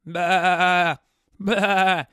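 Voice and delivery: drawn-out imitation sheep bleating. Calls oblivious people sheep.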